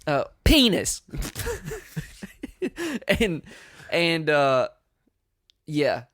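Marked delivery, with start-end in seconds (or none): 0.4-1.0 put-on toddler voice. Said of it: "So he says". 1.1-3.0 giggling, high and squeaky; high squeaky laugh. Real, not acted. Laughing after the quote.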